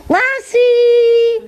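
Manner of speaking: hammed-up phone home. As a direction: croak it out like a lost alien. E.T. line again, bigger.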